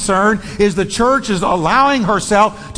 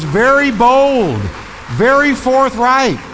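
emphatic preaching; 6 semitones higher, 4 dB louder, slower than usual. Real, not acted. Preacher hammering the point.

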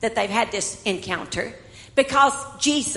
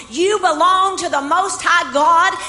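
louder, higher, rising preacher's cry; preaching at full force; 6 semitones higher, 6 dB louder than usual. Real, not acted. Preacher declares to the congregation, full force. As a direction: proclaim it to the whole church.

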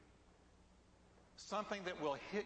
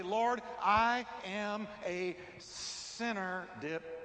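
fervent confession; 4 dB louder than usual. Acted. Confession with repeated dip.

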